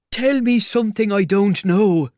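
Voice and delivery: high, loud, sarcastic retort; pained exasperation. A narrator's reading. Tramp in pain to the invisible man, exasperated. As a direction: snap back loudly through the pain.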